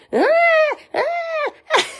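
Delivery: high squeaky puppy whimpers; puppy cries. Retold or imitated. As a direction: squeak like newborn puppies. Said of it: "I heard all the puppies going".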